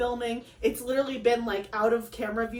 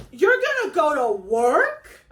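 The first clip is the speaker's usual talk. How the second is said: louder, slow disbelieving question; can't believe he's leaving for work; incredulous; 4 semitones higher, 8 dB louder, slower than usual. Retold or imitated. Wife to husband heading to work, stunned and louder.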